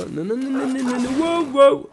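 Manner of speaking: rapid high no's rising into a loud cry; high, alarmed outburst as danger closes in; panicked. Real, not acted. Player seeing the bear come at him, panicked.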